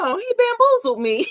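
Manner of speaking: very high-pitched imitation of disbelief; mock outrage. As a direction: squeal it like someone playfully outraged. Acting out duped client's reaction.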